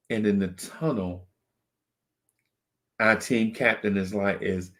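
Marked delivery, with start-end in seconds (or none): none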